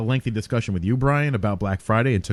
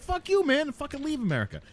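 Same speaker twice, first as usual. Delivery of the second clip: mocking angry critic; 4 dB quieter than usual. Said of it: "They'd be like".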